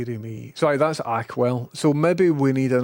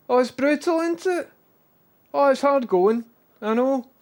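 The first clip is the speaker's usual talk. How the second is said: sympathetic pet talk; 11 semitones higher than usual. Real, not acted. Sympathising with the dog.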